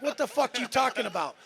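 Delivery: ordinary talk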